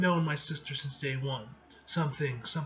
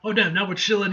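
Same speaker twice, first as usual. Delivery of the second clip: ordinary delivery, nothing marked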